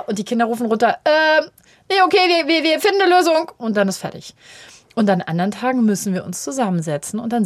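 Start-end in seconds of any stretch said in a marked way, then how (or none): 1.0-3.5 put-on high, loud voice of children calling back; children answering their mother from upstairs, high and loud; kids calling down. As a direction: play the kids shouting down the stairs.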